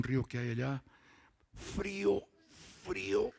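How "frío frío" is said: mock shiver. Recalls icy river baptism.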